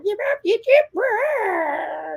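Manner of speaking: very high-pitched yipping creature voice; squeaky yips into a drawn-out cry; killer clown gibberish. Retold or imitated. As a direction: imitate a babbling clown animatronic. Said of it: "nailed that impression".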